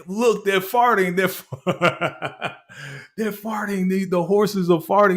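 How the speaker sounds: put-on a little boy cracking up at the horses; giggling little boy. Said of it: "This little boy is like".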